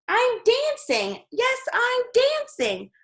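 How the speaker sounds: high, slow, delighted exclamation; joyful cry. A narrator's reading. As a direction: cry out in pure joy. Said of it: "Gerald cried".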